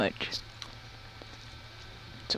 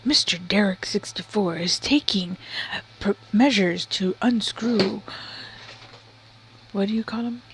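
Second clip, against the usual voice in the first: mock ASMR whisper narration; 4 dB quieter than usual. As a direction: narrate softly like an ASMR video.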